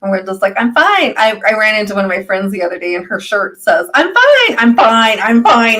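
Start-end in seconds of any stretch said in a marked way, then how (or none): 0.6-1.1 quick, high-pitched denial; mock reassurance, pitched up; pretending to be fine. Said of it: "We hide from ourselves so much". 3.9-5.8 hammed-up reassurance; mock insistence. Insistent shirt slogan acted out.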